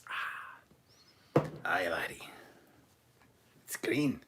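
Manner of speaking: a whispered, half-hearted Scottish brogue; a hushed stab at a Scottish engineer's burr; a weak Scottish accent, mostly breathed out; Scotty impression. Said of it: "Speak like Scotty when drinking".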